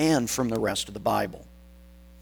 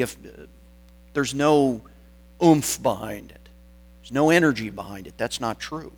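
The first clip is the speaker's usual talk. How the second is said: usual speaking voice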